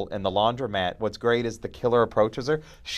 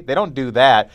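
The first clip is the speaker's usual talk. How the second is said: ordinary delivery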